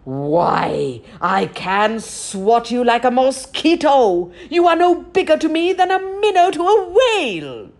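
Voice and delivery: loud, booming giant roar. Acted. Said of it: "the giant roared".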